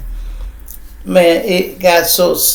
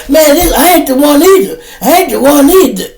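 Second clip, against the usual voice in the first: louder, higher warning; heated comeback; 10 semitones higher, 7 dB louder than usual. Retold or imitated. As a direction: push back hard, fed up. Customer arguing back.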